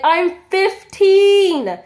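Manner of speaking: high, loud, drawn-out protest; exasperated outburst. Retold or imitated. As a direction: spell it out slowly in disbelief. Man pressing too close behind.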